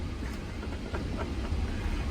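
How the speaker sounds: laughing. Real, not acted. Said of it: "Oh my God, I cracked myself up".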